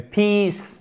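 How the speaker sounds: PEACE with a high, long "ee". Shown as a demonstration. Teacher illustrating the long i for learners, drawn out. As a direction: stretch the vowel of Peace, falling from high. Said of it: "ça, c'est un i long".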